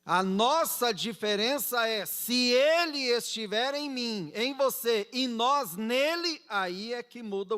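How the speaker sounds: loud, high-pitched pulpit proclamation; impassioned preacher in full voice; emphatic preaching. Real, not acted. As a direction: build it up fervently for the congregation.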